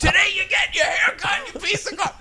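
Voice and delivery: high-pitched barked threat, imitated; drill instructor's yell. Instructors yelling at recruits on haircut day, high mock yell. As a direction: bark the order at recruits. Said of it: "They yelled at us".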